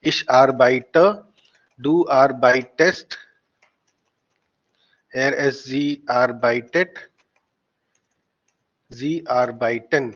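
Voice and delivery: arbeite, arbeitest, arbeiten drawn out syllable by syllable; slow model pronunciation. Shown as a demonstration. Teacher models arbeite forms for the class, slow and deliberate. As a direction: say each arbeite form slowly and distinctly for learners. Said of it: "इसको ऐसे ही बोलना है".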